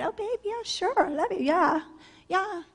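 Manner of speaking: mock sweet-talk. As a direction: coo it like a stripper working a client. Mocking sweet talk to clients.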